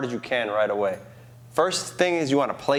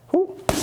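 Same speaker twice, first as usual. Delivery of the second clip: sharp high whoop on the shift; whoop sound effect. Shown as a demonstration. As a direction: vocalize the slip. Coach vocalizing the shift.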